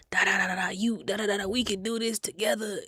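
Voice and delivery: lightly voiced older man coaxing; a hint of a creepy whisper-soft pitch; mock smooth barber. Imitating barber's persistent offer.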